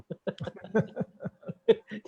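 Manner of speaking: a soft unvoiced rising laugh; breathy laugh. Real, not acted. Speaker chuckling at his own buffalo proverb for the audience.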